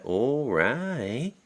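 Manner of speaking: a light Minnesota All right, sung up high at the end; brief Minnesota accent, lilting rise. Retold or imitated. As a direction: Marge Gunderson Minnesota accent, lightly done. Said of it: "if you remember Fargo with, uh, Marge Gunderson".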